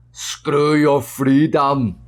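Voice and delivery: slow, drawn-out Austrian-accented imitation; Arnold Schwarzenegger. Quoting the actor's remark to viewers, slow Austrian accent. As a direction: imitate an Austrian action star, stretching each word. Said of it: "And Arnold said".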